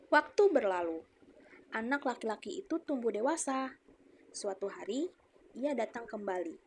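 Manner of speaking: put-on loud, lively read-aloud for children; story narration for schoolchildren; storytelling narration. A narrator's reading. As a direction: tell the tale to a class. Reads story to class.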